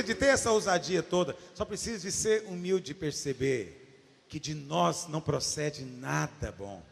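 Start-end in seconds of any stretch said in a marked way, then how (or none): none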